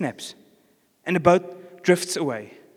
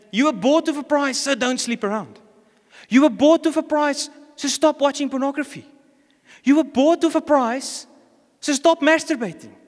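high, forceful preaching delivery; emphatic exhortation; 6 semitones higher than usual. Acted. Preacher voicing Paul's warning to believers, emphatic exhortation. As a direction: drive each command home with raised pitch.

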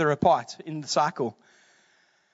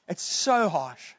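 normal talking voice

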